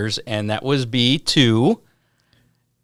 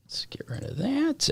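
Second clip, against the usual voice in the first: whispered aside; 9 dB quieter than usual. Real, not acted. Off-mic aside.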